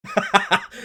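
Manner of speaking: short rueful laugh at the score. Real, not acted. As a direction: laugh it off in disbelief. Team blows the lead.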